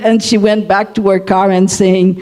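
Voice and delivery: ordinary talk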